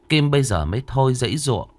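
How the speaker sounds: ordinary delivery, nothing marked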